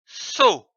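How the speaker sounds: "So" said slowly and distinctly as a model; clear syllable demo. Shown as a demonstration. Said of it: "c'est dit O".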